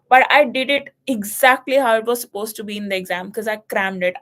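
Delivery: normal talking voice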